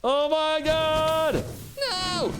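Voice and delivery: high, drawn-out cry of dismay; stretched, high despairing shout; anguished shout. Real, not acted. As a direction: wail it as you lose. Dying in game.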